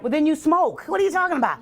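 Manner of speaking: high, mocking disbelief; incredulous retort. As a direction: call their bluff.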